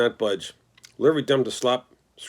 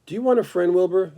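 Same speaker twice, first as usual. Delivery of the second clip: thin, pleasant voice; 7 semitones higher than usual. Acted. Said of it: "It sounded rather thin, but pleasant".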